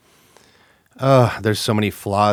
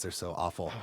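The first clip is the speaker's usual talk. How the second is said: ordinary delivery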